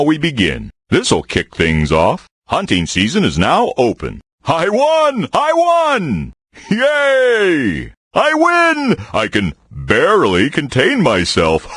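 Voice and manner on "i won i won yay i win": high, squealing cheers of victory; gleeful victory cheer. Acted. Bear celebrating his game win, squealing with glee. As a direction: crow over the win like a delighted bear.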